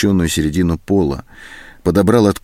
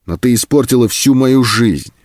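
raised, higher-pitched reproach; angry accusation; 5 semitones higher, 4 dB louder than usual. Acted. Captor blaming the bound woman, angrily accusing. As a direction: accuse with mounting bitterness.

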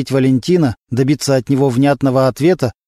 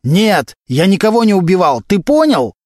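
high, desperate outburst; shouted denial; 6 semitones higher, 3 dB louder than usual. A narrator's reading. Suspect insisting he killed nobody, shouting. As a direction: cry out your innocence, pitch raised. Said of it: "Валентин настолько громко кричал".

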